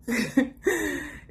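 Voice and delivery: high burst of laughter; real laugh. Real, not acted. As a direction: laugh at the absurdity. Laughing at situation.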